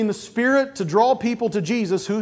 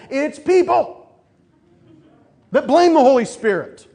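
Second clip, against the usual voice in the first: loud, high, punching the blame; emphatic preaching; 6 semitones higher, 6 dB louder than usual. Real, not acted. Preacher calling out his congregation, loud and emphatic. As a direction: drive the point home hard.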